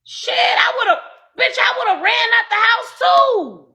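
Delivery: real outraged shout. Real, not acted. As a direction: blast it in disbelief. Outraged rant on stream.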